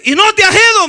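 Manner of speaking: high, loud retold outburst; angry disbelief. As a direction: act out an exasperated man at his door. Man reopens door, still hostile. (grito exasperado, imitado)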